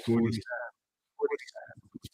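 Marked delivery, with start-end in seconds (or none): 1.2-2.1 whispered fare. Acted. Bus fare call in joke.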